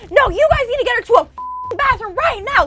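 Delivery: loud, very high-pitched furious demand; shrill-pitched mock scream at full push; screaming mom. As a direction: imitate an irate mother screaming orders. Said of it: "Like, she was screaming".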